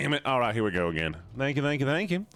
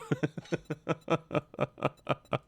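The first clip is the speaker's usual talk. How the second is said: long, higher-pitched fit of laughter; real laughter; 5 semitones higher, 4 dB quieter than usual. Real, not acted. Player cracks up over teammate's death, real laughter. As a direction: laugh helplessly, in a long run.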